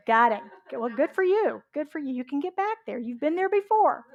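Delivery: ordinary delivery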